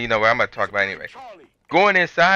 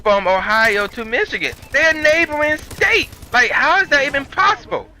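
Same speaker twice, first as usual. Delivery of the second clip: can't believe they lost it; rising disbelief, pitched way up; exasperated disbelief; 8 semitones higher than usual. Retold or imitated. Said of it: "So now I'm sitting here like".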